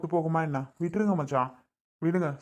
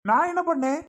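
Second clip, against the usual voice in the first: husband shouting back at his wife in a quarrel; angry outburst; 7 semitones higher than usual. Acted. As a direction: snap back in disbelief. Husband flares at wife's reply.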